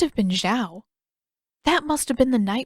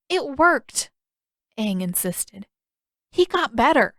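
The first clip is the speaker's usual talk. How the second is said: put-on much higher, louder boyish insistence; insistent Aang; 5 semitones higher, 4 dB louder than usual. Aang defending the frog cure to Katara, high insistent. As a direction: insist earnestly.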